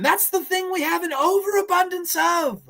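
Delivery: very high, put-on clown voice. Wisecracking clown answering the others' theories, high clown voice. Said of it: "I love the clown says that".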